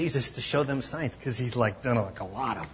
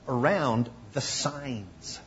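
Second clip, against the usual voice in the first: usual speaking voice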